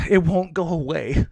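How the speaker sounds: weary, sighing complaint; exasperated sigh. Real, not acted. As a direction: sigh it out, fed up.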